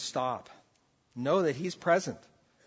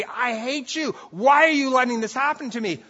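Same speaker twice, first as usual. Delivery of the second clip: higher and louder, lashing out; raised angry accusation; 9 semitones higher, 8 dB louder than usual. Retold or imitated. Believer in a trial raging at God, an angry outcry. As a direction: hurl it at God like a shaken fist. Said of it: "shake a fist at God and say".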